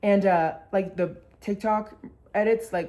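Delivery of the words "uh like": usual speaking voice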